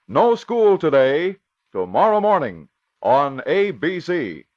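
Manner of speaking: ordinary talk, nothing marked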